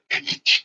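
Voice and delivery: quick hissy sound effect of snagging; imitated snagging noise. Soldier showing brush grabbing his gear, imitated snagging noise. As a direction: mimic gear catching with sharp shushy bursts. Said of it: "whatever's around me grabs a hold".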